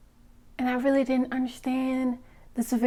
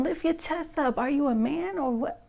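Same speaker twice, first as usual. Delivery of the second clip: ordinary delivery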